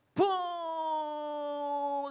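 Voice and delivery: high "buuu" gliding slowly down; long plane whoosh. Retold or imitated. Preacher imitates long flight to Taipei, drawn-out plane noise.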